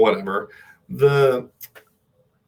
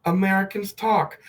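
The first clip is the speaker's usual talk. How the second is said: higher, hammed-up American accent; pitched-up fake American, badly done; 5 semitones higher than usual. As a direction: put on a clumsy cliché American voice. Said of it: "This is bad".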